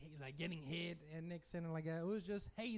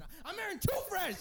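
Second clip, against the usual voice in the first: silly joke voice; 5 dB louder than usual. Pretend. Joking odd voice, then "just playing.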